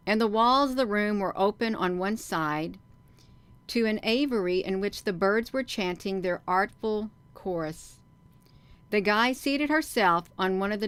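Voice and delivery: lively, emphatic read-aloud with swinging pitch; story narration. A narrator's reading. Reads the book aloud.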